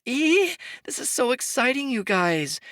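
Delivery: high, thrilled squeal; excited squeal. A narrator's reading. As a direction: gush with delight. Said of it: "Imogen cried".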